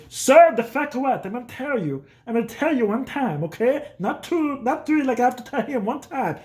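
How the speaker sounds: high, slowed mock warning; hammed-up angry father laying down the law; dad warning the neighbor; dad threatening. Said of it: "He goes".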